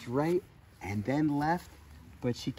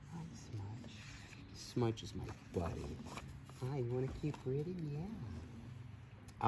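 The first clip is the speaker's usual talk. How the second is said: soft pet talk; 11 dB quieter than usual. Real, not acted. Talking softly to the goat.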